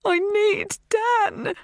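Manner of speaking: slow, very high wail; drawn-out tearful cry. A narrator's reading. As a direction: plead through tears. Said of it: "I say, tears flooding my eyes".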